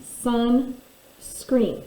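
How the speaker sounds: "sunscreen" stretched slowly as one whole word; slow blend. Shown as a demonstration. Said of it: "I have the word sunscreen".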